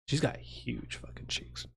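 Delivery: soft unvoiced whisper; whispered aside. Real, not acted. Streamer whispers a cheeky aside about his wife. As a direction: lean in and whisper it like a naughty secret.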